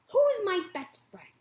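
put-on high, loud, doubtful young voice; suspicious gosling. Gosling demands proof from the robot mother. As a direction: test her with each question.

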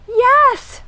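high, short storybook yell. A narrator's reading. Mouse answering from the hole, yelling. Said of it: "Mouse yelled".

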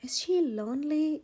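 ordinary delivery, nothing marked

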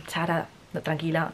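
hushed reassuring voice, a touch of calm; low-key lightly voiced reassurance; soothing friend. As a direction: quietly tell her to calm down. Boyfriend calming her in story.

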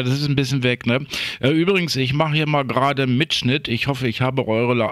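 ordinary talk

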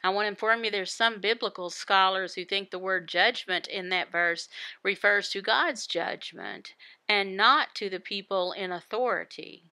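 ordinary talk, nothing marked

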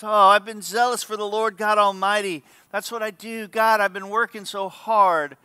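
mock whiny complaint to God. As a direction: plead like a put-upon complainer. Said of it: "Whiny voice".

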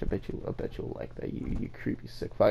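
mock-creepy whisper to the viewers; whispered playful insult; teasing whisper. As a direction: breathe it low like a joke.

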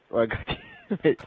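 ordinary talk, nothing marked

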